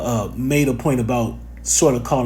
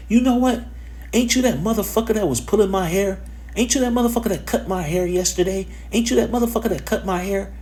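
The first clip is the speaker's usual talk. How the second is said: higher-pitched imitation of an accusing woman; 9 semitones higher than usual. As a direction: play the stranger confronting him, a touch heated. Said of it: "she turns around and say".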